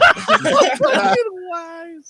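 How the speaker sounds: high cackling laugh; real burst of laughter. Real, not acted. Host cracking up at the fart joke, laughing hard. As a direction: break up laughing.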